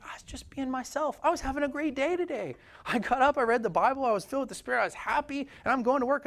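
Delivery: cheerful and wide-eyed, voice lifted high; innocent cheerful believer. Retold or imitated.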